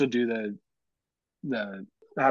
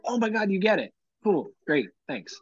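higher-pitched, delighted recognition; finally someone understands you, pitch jumping up; retold gushing relief; 7 semitones higher than usual.

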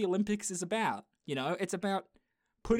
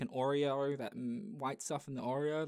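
ordinary talk

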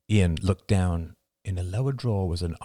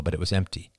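ordinary delivery, nothing marked

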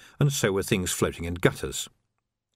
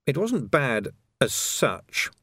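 ordinary delivery, nothing marked

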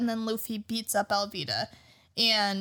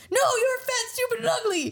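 very high mock outburst; defiant retort; 11 semitones higher than usual. Colby standing up to Alvida, high squealed mock defiance. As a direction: throw it back in a high, hammed-up voice.

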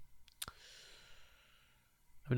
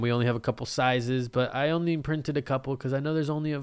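ordinary delivery

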